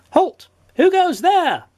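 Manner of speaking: high-pitched guard's challenge; goblin sentry's cry. A narrator's reading. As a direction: cry out like a little goblin on watch. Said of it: "cried the goblin sentry".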